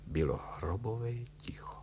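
half-whispered, hushed narration; storyteller hushing for a deathly silence. A narrator's reading. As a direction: let the voice sink to a near-whisper.

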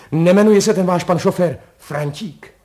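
loud, high, eager question; blurted out. A narrator's reading. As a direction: burst out with a sudden hunch.

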